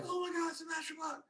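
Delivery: very high, softened mock fangirl squeal; swooning fangirls. Girls spotting the rock star above the crowd, mock swooning. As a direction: imitate starstruck girls, small and high-pitched. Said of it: "all the girls are like".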